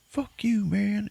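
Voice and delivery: high, panicky retort; hammed-up frightened onlookers; mock scared crowd. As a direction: squeal it high as a frightened bunch. Crowd snaps back at Denzel.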